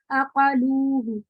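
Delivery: اقالوہ recited slowly, the final ہ drawn out with rounded lips; slow tajweed demonstration of اقالوہ; Quranic recitation. Shown as a demonstration. Teacher recites an example for the students, slowly. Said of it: "ہائے ضمیر کے ما قبل اگر واؤ ساکنہ".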